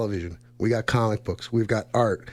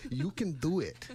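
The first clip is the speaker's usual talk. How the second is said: softer first go at the catchphrase; movie catchphrase imitation; 3 semitones higher, 9 dB quieter than usual. Host encourages nervous co-host, soft catchphrase imitation. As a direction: lightly imitate a comedy-film cheerleader, quietly. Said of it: "Like they say in all those Adam Sandler movies".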